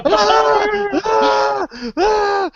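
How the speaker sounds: put-on high, frantic wordless screams of a terrified old man; ragged old man's high panicked shrieks; panicked screaming. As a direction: shriek like a cornered madman. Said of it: "he just starts screaming".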